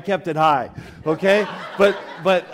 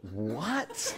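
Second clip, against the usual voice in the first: soft, rising, drawn-in "what?"; mock disbelief; 3 semitones higher, 8 dB quieter than usual. Mom hears he came alone, mock hushed disbelief. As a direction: imitate a mother's hushed shock. Retelling the mother's shocked reply.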